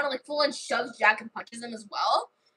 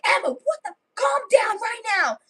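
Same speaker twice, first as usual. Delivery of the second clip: exasperated; 10 semitones higher than usual. Acted. Jack stopping Emma after she slaps Alara, high exasperated command. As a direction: order her in a high, exasperated voice. Jack breaks up Emma's fight.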